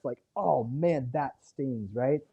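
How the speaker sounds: ordinary talk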